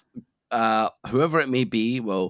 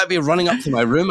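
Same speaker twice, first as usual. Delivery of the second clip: ordinary talk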